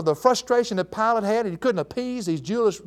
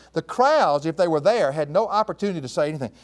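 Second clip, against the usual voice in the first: ordinary talk, nothing marked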